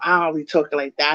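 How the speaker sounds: ordinary talk, nothing marked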